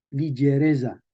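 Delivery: ligereza as li-zhe-RE-dza; medieval pronunciation. Shown as a demonstration. Teacher repeating for students, medieval pronunciation. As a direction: say ligereza with zh and voiced z. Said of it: "en español medieval se leía".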